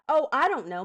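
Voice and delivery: exasperated fake wondering; sarcastic. Real, not acted. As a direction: answer with biting sarcasm. Sarcastic answer to a viewer's question.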